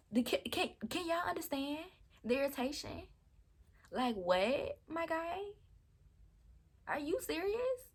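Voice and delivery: high, incredulous rising voice; exasperated disbelief. Real, not acted. Vlogger venting to viewers, high incredulous exasperation. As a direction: pitch it way up in disbelief.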